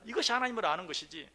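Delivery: ordinary delivery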